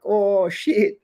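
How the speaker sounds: high, sudden oh shit; surprised realisation. Retold or imitated. Hearing about the crack house raid, high surprised reaction. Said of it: "I was like".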